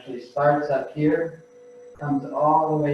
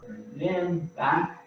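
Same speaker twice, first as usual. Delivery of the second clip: gently pace the class's breaths, quieter; guided breathing cues; 9 dB quieter than usual. Acted. Instructor pacing the class's breaths, soft and calm.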